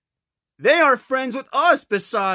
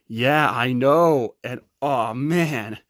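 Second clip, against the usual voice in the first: Patrick voice; 10 semitones lower than usual. Pretend. Kid voicing Patrick toy.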